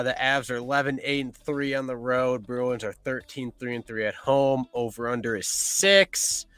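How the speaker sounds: ordinary talk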